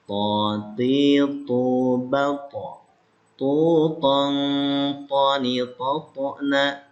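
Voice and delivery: crisp drill syllables To, ti, tu, each one clear and separate; articulation drill. Shown as a demonstration. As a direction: model the syllables for pupils to copy. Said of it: "Ah, baca". (latihan makhraj, suku kata jelas)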